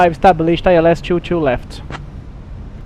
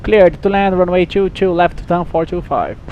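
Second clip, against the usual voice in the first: pilot cockpit headset; 6 semitones higher, 5 dB louder than usual. Real, not acted.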